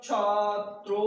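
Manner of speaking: छात्रो in two long, heavy syllables, छा and throw; heavy sound. Shown as a demonstration. Teacher modelling the dual form for students, heavy drawn-out छात्रो. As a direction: draw out छात्रो with full weight on the final vowel. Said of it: "आपकी sound heavy होना चाहिए".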